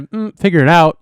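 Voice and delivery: loud, blown-out clipping; mic overloading and distorting; clipping distorted mic. Real, not acted. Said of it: "Why do I sound so blown out?".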